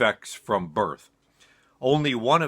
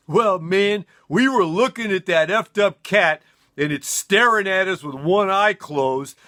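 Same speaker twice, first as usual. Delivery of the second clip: higher, lightly voiced stoned buddy telling the tale; stoned roommate; 8 semitones higher, 4 dB louder than usual. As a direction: spin the story like a proud, wasted college guy. Roommate recounts yanking the neck.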